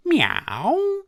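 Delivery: very high, loud drawn-out miaow; caterwauling meow; yowling cat. Acted. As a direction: sing it like a cat on a wall. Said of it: "sang the cats on the wall".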